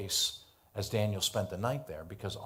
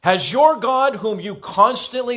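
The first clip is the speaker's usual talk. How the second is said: high, loud, anxious calling out; a king shouting his fear into the den; troubled cry; 13 semitones higher, 13 dB louder than usual. A narrator's reading. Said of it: "with a troubled voice".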